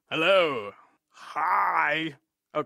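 normal talking voice

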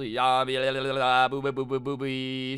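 fast rolling nonsense syllables; imitated ritual babble. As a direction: babble like a mock possessed shaman.

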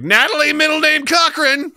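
high, very loud full-name yell; mock parental scream. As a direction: bellow the name like a furious parent. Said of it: "I just scream obscenities at her".

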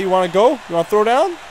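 ordinary talk, nothing marked

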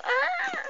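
sudden high shriek; pretend scream. Hairdresser's customer screams mid-haircut. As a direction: shriek as if something went wrong.

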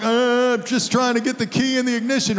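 high, loud mock struggle; hammed-up effortful groan into words; straining with effort. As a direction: act out straining to lift a sore arm. Acts out failing to lift his arm.

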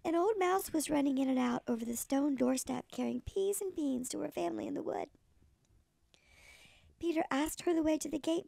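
ordinary delivery, nothing marked

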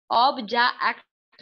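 ऑब्जेक्ट broken into three pieces, ob-ja-akt; wrongly split word. Shown as a demonstration. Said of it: "पूरी sound को एक पता नहीं क्या बना डाला है".

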